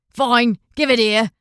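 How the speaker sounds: loud, curt giving in; snatch the money; grudging fine. A narrator's reading. Fisherman grudgingly accepting Guyon's coins.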